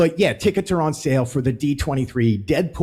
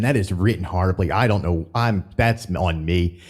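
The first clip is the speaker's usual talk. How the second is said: ordinary delivery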